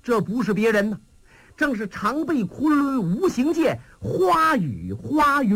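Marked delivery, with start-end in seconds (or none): none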